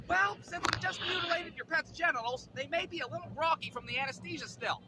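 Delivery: high-voiced vet staffer addressing owners; vet staff speech. Vet staff briefing pet owners, put-on high speech. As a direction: open high, like a chirpy little briefing. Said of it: "They give us all a quick speech".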